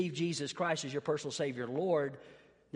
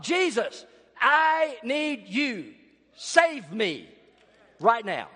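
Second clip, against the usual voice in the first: higher, louder cry calling out to Jesus; retold yell; 5 semitones higher, 6 dB louder, slower than usual. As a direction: reenact the man crying out in a coffee shop. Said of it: "he starts yelling out".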